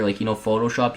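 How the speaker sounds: ordinary talk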